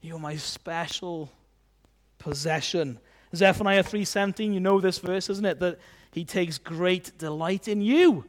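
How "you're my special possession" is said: slow, soft, tender assurance; tender God. Acted. God telling his people he treasures them, soft tenderness. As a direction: say it gently, pausing before the last word. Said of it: "he sees you as a special possession".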